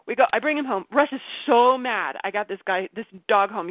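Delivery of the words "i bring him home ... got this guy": normal talking voice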